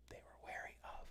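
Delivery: hushed, voiceless hint to the class; soft whispered lead-in left open; whispered prompt. Real, not acted. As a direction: whisper it, barely audible. Prompting students under the breath.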